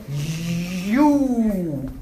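"you" held very long; heavy, lengthened emphasis on "you"; stretched YOU. Shown as a demonstration. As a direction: draw out "you" in one long swoop. Stretching the word to define it.

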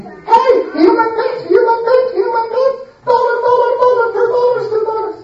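loud, high sing-song hawking; market crier. Acted. Seller at the market shouting his wares, loud and sing-song. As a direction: call out to the crowd like a street seller.

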